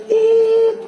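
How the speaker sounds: one long, very high, loud tit held like a flatlined monitor; flatline beep. Shown as a demonstration. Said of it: "Kalau mati itu". (bunyi tit panjang tinggi monitor jantung mati)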